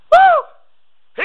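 loud, high preacher's whoop; revival-service whoop; excited whoop. Real, not acted. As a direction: let out a sudden piercing "woo". Preacher whoops before climax.

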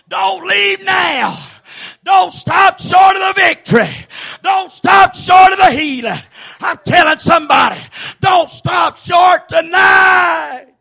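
high, loud whooping preacher cadence; fiery revival preaching; preaching at full force. Acted. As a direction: shout it out, driving each line home. Preacher builds to shouted climax.

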